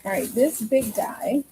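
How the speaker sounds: ordinary talk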